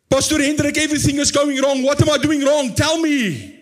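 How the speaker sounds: high, pleading cry for help; crying out to God. Retold or imitated. Pastor voicing a desperate believer crying out, pleading high. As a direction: beg for an answer, voice up high. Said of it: "I'm actually crying out".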